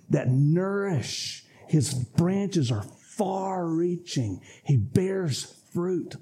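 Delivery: slow, lingering word-painting; slow, hushed sermon imagery; slow vivid description. Acted. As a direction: paint the tree slowly, fading to a whisper. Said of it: "Listen to what he starts to look like".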